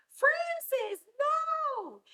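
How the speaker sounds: high, loud call after a runaway friend. Retold or imitated. Girl calls after running Francis, high loud yell. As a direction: yell his name to stop him.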